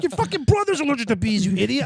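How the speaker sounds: dad scolding his grown son; exasperated dad. Retold or imitated. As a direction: scold him as an idiot. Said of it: "He's like".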